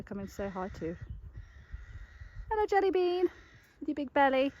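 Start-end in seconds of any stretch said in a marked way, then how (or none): 2.5-4.5 much higher cooing greeting; high pet talk. Real, not acted. Owner greeting her pregnant mare in a high pet voice. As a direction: coo fondly at the round-bellied mare.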